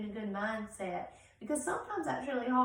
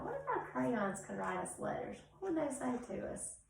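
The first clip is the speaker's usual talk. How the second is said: usual speaking voice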